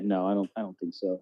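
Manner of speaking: usual speaking voice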